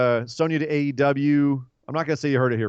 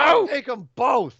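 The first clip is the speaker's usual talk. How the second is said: very high, louder mock wrestler yell; Tommy Dreamer; 13 semitones higher, 4 dB louder, slower than usual. Co-host teasing with a wrestler's line, hammed-up yell. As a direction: holler it like a hammed-up wrestling moment. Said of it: "it's like Tommy Dreamer in E C W".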